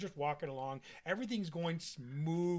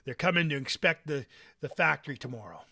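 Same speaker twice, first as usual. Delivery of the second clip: gruff father; 13 dB louder than usual. Acted. GM voices the father.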